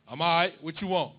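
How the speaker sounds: loud, gruff dad cutting to the chase; impatient dad. Retold or imitated. Son calls dad, dad loud and impatient. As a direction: bark it like a dad who knows you want money.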